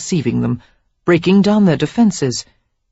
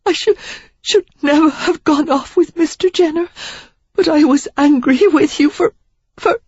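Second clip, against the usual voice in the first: much higher, broken and halting; Sarah confessing to Derek while crying; tearful; 12 semitones higher, 4 dB louder than usual. A narrator's reading. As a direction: stammer through tears.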